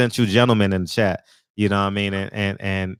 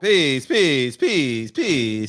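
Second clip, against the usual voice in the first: fading repeated greeting; 3 semitones higher, much slower than usual. Real, not acted. Host echoing caller's greeting.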